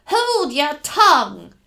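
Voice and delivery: loud, slow, angry command; harsh order. Acted. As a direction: silence her, hard and loud. Captor silencing her.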